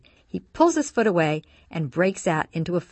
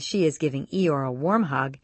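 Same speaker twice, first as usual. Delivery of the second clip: ordinary delivery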